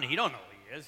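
ordinary talk, nothing marked

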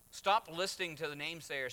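ordinary talk, nothing marked

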